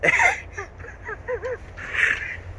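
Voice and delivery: a high giggle that breaks up; high squealing laugh. Real, not acted. Laughing at the fishing shop mix-up, high squeal. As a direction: laugh at her own joke.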